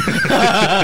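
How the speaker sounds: sudden high burst of laughter; cracking up at the story; loud laugh. Real, not acted. Host cracking up at the story with a loud laugh.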